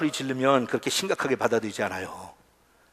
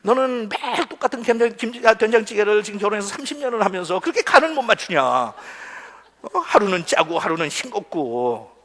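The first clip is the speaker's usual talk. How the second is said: nagging complaint; 7 semitones higher than usual. Acted. Acts husband criticising wife's cooking.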